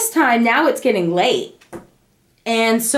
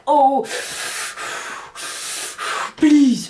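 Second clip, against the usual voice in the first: puffing through acted contractions; re-enacted labor breathing, pleading; 3 semitones higher than usual. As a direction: pant and plead between breaths. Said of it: "I was breathing hardcore".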